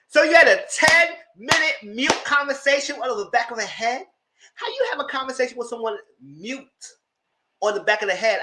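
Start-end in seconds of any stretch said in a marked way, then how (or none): 0.1-6.7 a high, disbelieving squeal; mock her in shocked disbelief; incredulous. Mocking friend's mute FaceTime.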